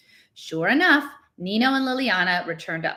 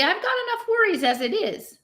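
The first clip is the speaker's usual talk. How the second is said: irritable. A narrator's reading. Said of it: "he said irritably".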